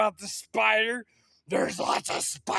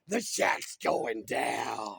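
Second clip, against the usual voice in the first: crazy mean voice; 8 semitones lower, slower than usual. Shown as a demonstration. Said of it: "No, go like this".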